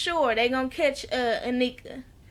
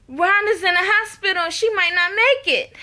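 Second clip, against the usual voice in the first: higher, louder urgent news; 5 semitones higher, 4 dB louder than usual. Retold or imitated. Becky bursting in to tell Lucius, urgent and raised. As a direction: blurt out the bad news.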